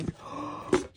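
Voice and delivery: soft, breath-only gasp; amazed gasp. Real, not acted. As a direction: suck in air in delighted surprise. Amazed reaction to the steamer basket.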